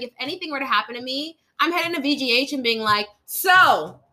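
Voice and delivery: high, sassy bragging; cocky swagger. Retold or imitated. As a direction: strut to the front of the line. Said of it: "in my mind, I'm like".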